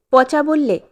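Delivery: ordinary delivery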